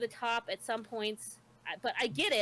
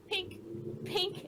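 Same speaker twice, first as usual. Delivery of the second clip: imitated arrow sound; 8 semitones higher than usual. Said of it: "the archer's just going".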